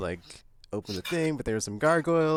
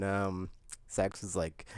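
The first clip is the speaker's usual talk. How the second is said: usual speaking voice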